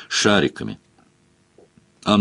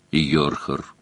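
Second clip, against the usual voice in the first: low, soft, toneless demon; emotionless demon voice; 6 semitones lower, 5 dB quieter than usual. A narrator's reading. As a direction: answer with no feeling at all. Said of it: "бесстрастно произнёс демон".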